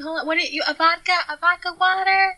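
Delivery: high-pitched, chatty bar-worker impression; Craig the bartender. Bartender taking a drink order over club noise, high impression. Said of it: "That is what he would sound like".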